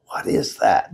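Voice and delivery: unvoiced hushed whisper. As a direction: breathe it out in a whisper. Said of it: "And we're whispering".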